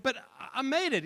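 put-on small boy insisting he made it; protesting boy. A narrator's reading. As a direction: push back, hurt.

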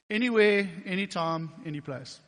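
loud, ringing call; loud proclamation. Retold or imitated. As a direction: proclaim it loudly. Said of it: "he said".

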